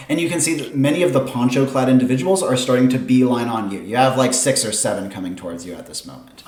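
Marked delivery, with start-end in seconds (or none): none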